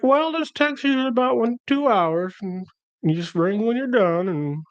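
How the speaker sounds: high, chirpy imitation of the nurse; hammed-up high nurse explaining the drip; high mock nurse voice.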